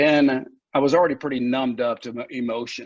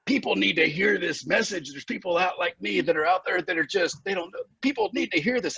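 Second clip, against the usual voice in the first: higher, fervent, the words rushing with zeal; fired-up eagerness; 6 semitones higher than usual. Retold or imitated. Recovering man retelling his new zeal, fired-up and higher. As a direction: preach it like you just found the answer. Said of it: "I was so on fire".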